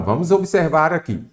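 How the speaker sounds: ordinary delivery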